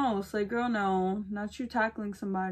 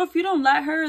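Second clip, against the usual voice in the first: normal talking voice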